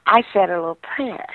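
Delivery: normal talking voice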